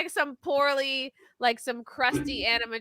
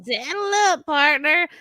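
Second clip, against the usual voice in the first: put-on lightly drawn Texas cowboy accent, a bit higher; a hint of a Texas cowboy greeting; 4 semitones higher than usual. Host teasing friends with a mild Texas accent. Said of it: "Every time I do a Texas accent".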